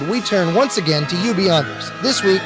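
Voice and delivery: ordinary talk, nothing marked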